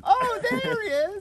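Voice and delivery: very high, loud, slow discovery; hammed-up delight at spotting him; excited show host. Shown as a demonstration. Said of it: "That's why I say go".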